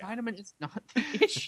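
laughing. Real, not acted. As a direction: crack up halfway through the quote. Cracking up while repeating the quote.

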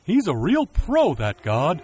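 slow, loud admiring cow; drawn-out praise from a cow. Acted.

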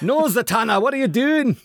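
high-pitched, hammed-up Scottish brogue; mock Scottish accent. Co-host correcting the doorman bit, mock Scottish accent. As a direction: squawk it like a cartoon Scot. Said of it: "can you do an accent?".

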